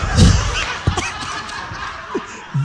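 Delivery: big airy laugh at his own story; loud breathy laugh. Real, not acted. Preacher laughing at his own joke, airy burst of laughter.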